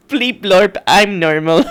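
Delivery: high beeping robot voice. Retold or imitated. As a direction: chirp it like a silly robot. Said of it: "robot voice".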